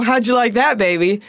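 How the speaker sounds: usual speaking voice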